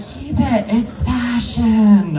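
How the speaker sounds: lightly mimicked gal-pal hype, last word drawn out; mock-encouraging friends, stretching the final word; hyping girlfriends. Girls talking performer into keeping bad makeup, mock hype. Voices girls cheering on a mistake.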